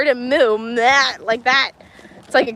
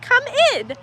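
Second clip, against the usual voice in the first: lightly voiced bossy toddler; a hint of a bossy little girl; 9 semitones higher than usual. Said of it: "she's definitely in charge".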